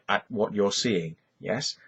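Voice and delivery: ordinary talk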